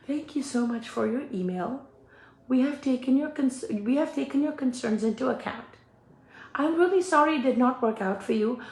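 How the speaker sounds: a soft, hint of a polite clerk; gently professional apology; customer service voice. Answering the customer's email in a soft polite service voice. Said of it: "in my customer service demeanor".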